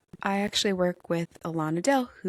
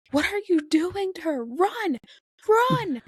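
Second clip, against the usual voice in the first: high-pitched, worked-up yelling at the story; retold mad outburst; 12 semitones higher, 4 dB louder than usual. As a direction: re-enact frantic yelling, peaking on the last word. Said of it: "I got so mad".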